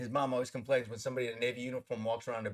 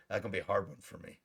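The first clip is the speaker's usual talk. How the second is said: lower, softer muttered admission; quiet dismay; 4 semitones lower, 7 dB quieter than usual. Retold or imitated. Actor recalling his reply to the military guy, quiet dismay. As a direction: say it low to yourself, deflated.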